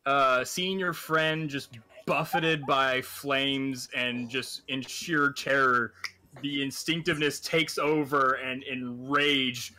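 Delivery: slow, swelling epic narration building to a shout; dramatic narration. Acted.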